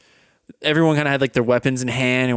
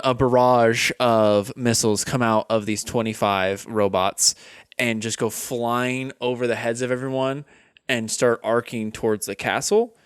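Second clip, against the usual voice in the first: ordinary delivery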